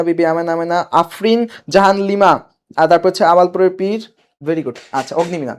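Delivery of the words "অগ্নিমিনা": অগ্নিমিনা with an m in place of b; অগ্নিমিনা, the misspelling read out as written; misspelt reading. Shown as a demonstration. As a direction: read অগ্নিমিনা with "-mina" at the end. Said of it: "এটা কি হবে?".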